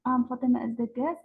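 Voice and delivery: normal talking voice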